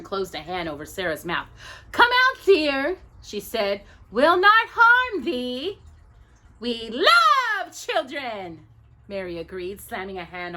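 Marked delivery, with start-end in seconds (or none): 1.9-3.0 high, loud sweet witch coax. A narrator's reading. Witch coaxing child. 4.1-8.7 high, slow, honeyed witch; slow sugary witch. A narrator's reading. Witches luring child.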